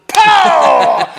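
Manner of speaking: loud, drawn-out impact sound; shouted pow. Retold or imitated. Imagining the guy coming out of left field, loud pow. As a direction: explode on a long pow. Imagined surprise hit from the man.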